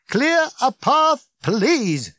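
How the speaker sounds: slow, high, loud command; drawn-out yell over a crowd; shouting. A narrator's reading. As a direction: stretch out each word in a bellow. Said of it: "shouted Ford again".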